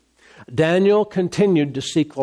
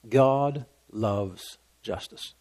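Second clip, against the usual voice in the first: low and very slow, let each word land softly; a hushed, deliberate closing truth; slow solemn hush; 7 semitones lower, 7 dB quieter, much slower than usual. Real, not acted. Preacher closing the sermon, slow and hushed.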